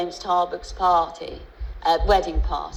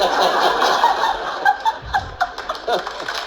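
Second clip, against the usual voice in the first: high unvoiced burst of laughter; big laugh; 6 dB louder than usual. Real, not acted. Guest cracking up at her husband's joke, big laugh. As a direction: laugh out loud at the memory.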